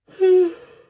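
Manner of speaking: loud, high exasperated sigh. Acted. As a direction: heave a big fed-up sigh. Exasperated after rude customer.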